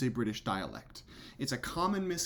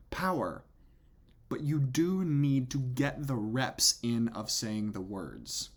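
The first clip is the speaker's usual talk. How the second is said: usual speaking voice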